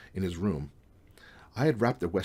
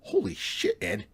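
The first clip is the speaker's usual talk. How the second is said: very high-pitched shocked outburst; shocked exclamation; 11 semitones higher, slower than usual. A narrator's reading. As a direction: exclaim it in alarm.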